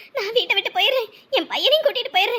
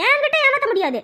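ordinary talk

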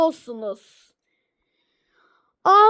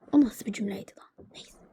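aside whisper; 9 semitones lower, 8 dB quieter, faster than usual. Acted. Kenara fısıltılı yorum.

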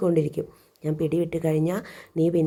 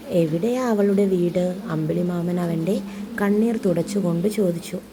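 animated storytelling with acted dialogue; story narration. A narrator's reading. (ഭാവത്തോടെയുള്ള കഥ വായന)

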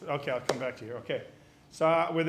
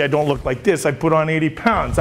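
usual speaking voice

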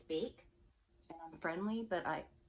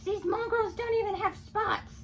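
high, loud, slow villainess sneer; Cruella de Vil; 11 dB louder, slower than usual. Acted. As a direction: sneer it like a haughty fur-mad villainess. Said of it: "Cruella is so obsessed with".

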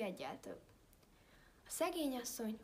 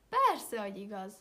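insistent wolf; 11 dB louder than usual. A narrator's reading.

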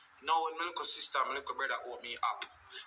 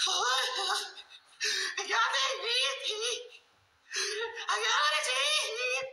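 high crying falsetto, slow at the end; mother bawling; much slower than usual. Retold or imitated. Son imitating his mother's bawl, high and crying. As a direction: sob it out like a wailing mother. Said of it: "Mother bawl like".

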